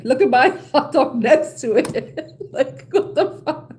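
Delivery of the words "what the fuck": ordinary talk, nothing marked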